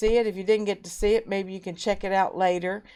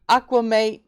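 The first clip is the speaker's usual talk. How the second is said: ordinary talk, nothing marked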